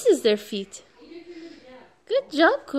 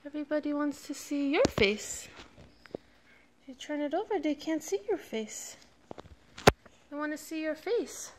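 soft coaxing; 10 dB quieter than usual. Real, not acted. Coaxing toddler to face camera.

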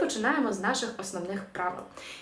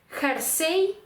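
«херсей» modelled as kher-SEY, pitched up; final-syllable stress; 6 semitones higher than usual. Shown as a demonstration. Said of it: "останній склад".

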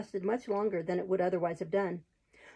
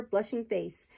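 ordinary delivery, nothing marked

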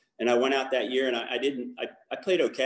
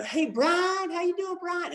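high-pitched mock Southern greeting, overly nice; nice Southern accent; 4 dB louder than usual. Everyone at Campus Crusade greeting the newcomer, mock-nice Southern accent. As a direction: imitate gushing Southern friendliness, pitched way up. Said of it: "so nice with the Southern accent".

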